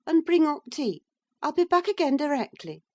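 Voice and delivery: put-on a bright commanding young wife; Catherine, eager lady. A narrator's reading.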